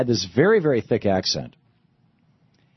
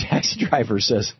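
ordinary talk

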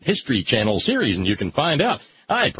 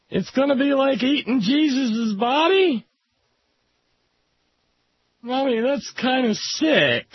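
put-on very high-pitched whiny kid on the verge of tears; scared little child; 13 semitones higher than usual. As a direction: play a frightened small child begging mommy. Child worried about mommy.

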